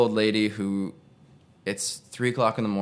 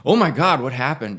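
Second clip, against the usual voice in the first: much higher and louder burst of alarm; shocked; 5 semitones higher, 6 dB louder than usual. Retold or imitated. Nurse seeing the bloody eyebrow, crying out in shock. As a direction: gasp it out at the sight of the wound.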